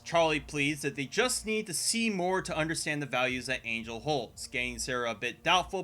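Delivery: normal talking voice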